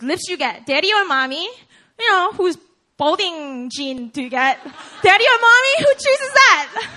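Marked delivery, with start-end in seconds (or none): 5.0-6.9 laughing high and loud, stretching it out; amused, incredulous laugh. Real, not acted. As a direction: laugh through the punchline. Preacher laughing, building to punchline.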